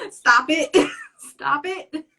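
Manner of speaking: high, loud giggles breaking up the words; laughing so hard the pitch shoots up; real laughing fit. Real, not acted. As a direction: talk through uncontrollable laughter. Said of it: "I'm laughing now".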